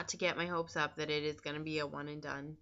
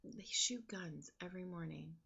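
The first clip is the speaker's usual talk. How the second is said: hushed whisper under the noise; a soft unvoiced whisper to the camera; whispered aside; 9 dB quieter than usual. Real, not acted. Aside about gunshots outside.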